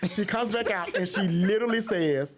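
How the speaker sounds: ordinary delivery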